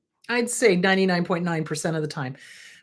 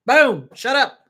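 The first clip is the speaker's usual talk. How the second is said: forceful shut up; 3 semitones higher, 6 dB louder than usual. Shown as a demonstration. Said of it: "you have to literally be like".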